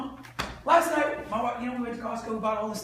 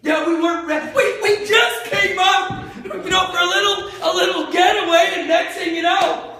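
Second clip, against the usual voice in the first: higher, louder grateful customer; happy customer; 8 semitones higher, 11 dB louder than usual. Acted. A customer thanking the salesman, excited and loud. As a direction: gush like a thrilled buyer. Customer telling how they bought.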